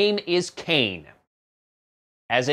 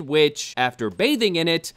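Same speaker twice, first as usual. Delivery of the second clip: normal talking voice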